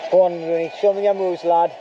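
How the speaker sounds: ordinary delivery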